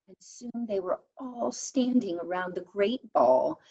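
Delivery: ordinary delivery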